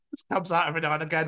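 ordinary talk

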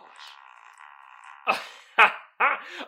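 low, loud laugh; low loud chuckle. Real, not acted. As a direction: laugh at your own disgust. Laughing at own disgust.

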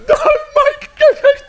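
high, laughing mock sob; mock crying wife. Pastor imitating his crying wife, hammed-up sob. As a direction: ham up her tearful cry. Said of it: "She just cried".

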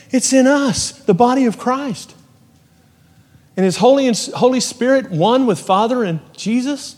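high, loud, driving preaching voice; full-force preaching. Real, not acted. Pastor preaching to the congregation at full force. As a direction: preach it up to the rafters.

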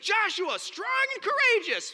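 high, drawn-out singsong of a tired slogan; mock sermon cliché. Teasing the Bible-study crowd's favourite slogan, high and drawn out. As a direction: parody the catchphrase everyone repeats.

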